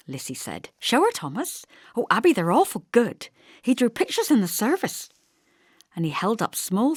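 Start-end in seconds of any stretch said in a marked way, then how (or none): 0.8-5.1 put-on eager little girl. Lissy eager, higher.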